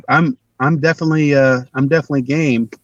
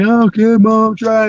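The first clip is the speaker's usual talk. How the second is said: raised, lightly sing-song answer; retold child's reply; 7 semitones higher, 3 dB louder, much slower than usual. Kid answering mom in the kitchen, higher placating reply.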